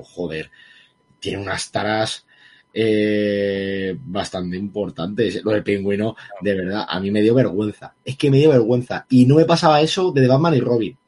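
ordinary talk, nothing marked

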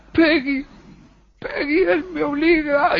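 high, slow crying plea. Acted. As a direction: sob it out, pleading. Possessed Peter pleading to sister.

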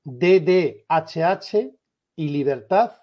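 slow, spaced-out delivery for note-taking; slow dictation. Shown as a demonstration. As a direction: spell it out slowly, letter by letter. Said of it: "lo voy a decir bastante despacio".

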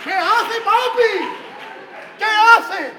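high, very loud outburst; loud, high-pitched challenge; shouted protest. Acted. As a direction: yell it in comic disbelief.

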